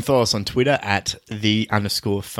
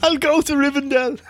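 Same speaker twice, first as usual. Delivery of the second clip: squeaky hobbit voice; high silly voice; 14 semitones higher than usual. Pretend. Put-on high voice.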